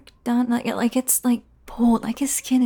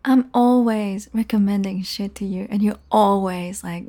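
usual speaking voice

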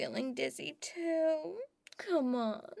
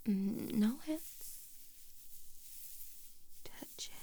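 soft intimate whisper, close to the mic; ASMR whisper; 4 semitones lower, 7 dB quieter than usual. Acted. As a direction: murmur tenderly into his ear.

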